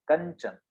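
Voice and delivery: कंचन with a ञ sound before च; word demo. Shown as a demonstration. Teacher showing students the ञ in कंचन. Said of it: "ञ का उच्चारण हमारा हो रहा है".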